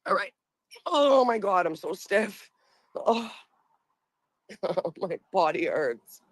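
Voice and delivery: ordinary talk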